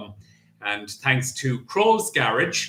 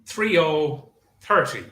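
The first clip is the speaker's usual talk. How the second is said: bingo caller; 3 semitones higher than usual. Acted. Bingo number calling.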